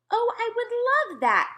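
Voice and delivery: the BFG cries out, thrilled by Sophie's idea; excited cry. A narrator's reading. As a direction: burst out with joy. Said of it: "cried the B F G".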